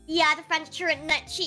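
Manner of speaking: usual speaking voice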